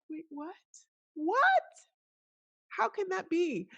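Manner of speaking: high-pitched, astonished disbelief; incredulous. Retold or imitated. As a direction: question it in high, baffled amazement. Acts out her disbelief at client.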